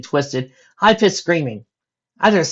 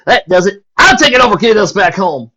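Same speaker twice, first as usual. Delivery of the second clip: loud, much higher, fed up; exasperated; 7 semitones higher, 11 dB louder than usual. Acted. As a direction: snap it out, fed up. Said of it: "Applejack said, exasperated".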